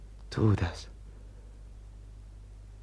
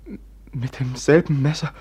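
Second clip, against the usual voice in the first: breathless, frightened half-whisper; fearful whisper. Acted. Brother asks about the murder knife in a frightened whisper. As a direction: ask it in dread, barely voiced.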